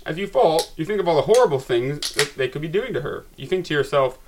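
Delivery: rising excitement, building to a shout; getting carried away reading; excited. Real, not acted. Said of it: "the excitement got to me".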